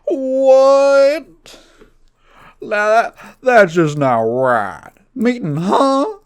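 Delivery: loud, very high, slow disbelief; Steve Harvey voice. Acted. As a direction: act stunned at the answer, dragging it out. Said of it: "And he goes".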